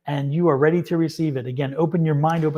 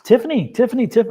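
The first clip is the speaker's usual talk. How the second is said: excited calling; 6 semitones higher, 5 dB louder than usual. Real, not acted. Excited by the card drawn.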